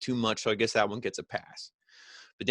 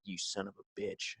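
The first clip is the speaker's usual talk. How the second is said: much softer, under the breath; quiet retold curse; 2 semitones lower, 13 dB quieter than usual. Dominic shakes his head looking at Seth, muttered mock curse. Imagined look at Dominic's opponent.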